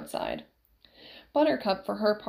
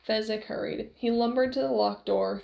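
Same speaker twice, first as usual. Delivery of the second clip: plain reading voice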